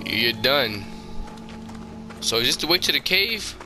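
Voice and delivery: ordinary talk, nothing marked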